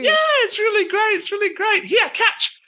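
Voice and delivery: high playful tiefling tossing a fireball; cheerful tiefling. Acted. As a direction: tease them with a high giddy lilt. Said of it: "she's like".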